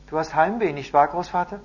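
a hint of an asking grandson; lightly voiced boy. A narrator's reading. Boy asks grandfather about his homesickness, lightly childlike.

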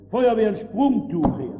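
shouted "Feuerwehr, ein Sprungtuch her"; man trapped in a burning house shouting for the fire brigade; calling for help. Acted. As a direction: yell it from the fourth floor.

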